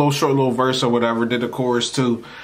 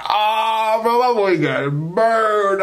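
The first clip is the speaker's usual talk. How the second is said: mock lament; 8 semitones higher, much slower than usual. Mocking cousin who got burned.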